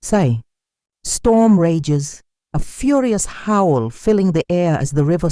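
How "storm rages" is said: plain reading voice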